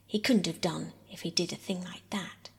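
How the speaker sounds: usual speaking voice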